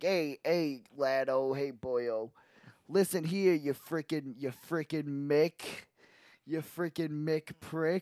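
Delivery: put-on rough Brooklyn tough-guy accent; playing a Brooklyn butcher thug. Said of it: "a Brooklyn accent".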